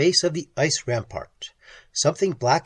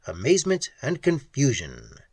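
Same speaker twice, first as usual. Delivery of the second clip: plain reading voice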